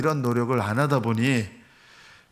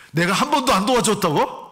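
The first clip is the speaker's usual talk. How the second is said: higher, offended retort; indignant husband; 7 semitones higher, 3 dB louder than usual. Retold or imitated. Husband snapping back at wife over "한 번도", indignant. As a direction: imitate a husband bristling at "never". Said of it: "한 번이라는 말에 꽂혀가지고".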